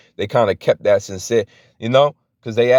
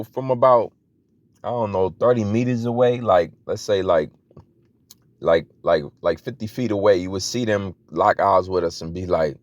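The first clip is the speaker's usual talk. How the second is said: usual speaking voice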